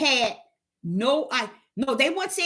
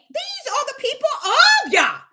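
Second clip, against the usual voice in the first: higher, louder quote with a laugh; mocking heathen; 12 semitones higher, 5 dB louder than usual. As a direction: toss it out with a laugh of disbelief. Heathens' line, laughing acted voice.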